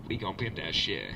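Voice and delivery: very low rapper-host voice. Retold or imitated. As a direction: drop way down, cool and assured. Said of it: "Exhibit comes out. He's like".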